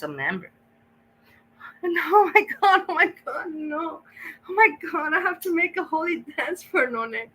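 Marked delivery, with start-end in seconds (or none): 1.8-7.3 high-pitched shrieks of joy; shrieking jackpot winner. Real, not acted. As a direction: shriek like you just won big. Excited reaction to a donation.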